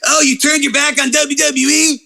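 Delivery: high-pitched put-on fan rant; mock outraged fan. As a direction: mimic an indignant fanboy. Mimicking outraged WWE fans.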